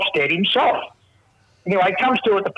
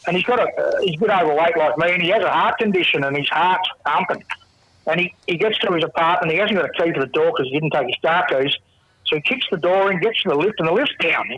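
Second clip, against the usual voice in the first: ordinary delivery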